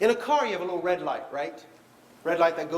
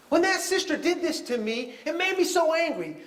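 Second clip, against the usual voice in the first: a hint of an indignant client, pitched higher; aggrieved client; 9 semitones higher than usual. Counselor imitating a client complaining about her sister, mildly indignant. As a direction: lightly play the upset client blaming her sister.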